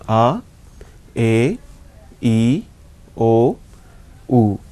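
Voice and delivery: A, A, E, O, U as "ah, ay, ee, oh, oo"; teacher's vowel drill on A, A, E, O, U, "ah" to "oo"; German vowel sounds. Shown as a demonstration. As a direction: recite A, A, E, O, U as separate pure vowels. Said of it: "So, this is".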